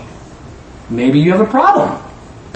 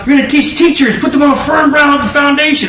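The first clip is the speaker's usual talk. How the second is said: prophetic declaration; 7 semitones higher than usual. Retold or imitated. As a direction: declare his future over him. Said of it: "the guy's talking about".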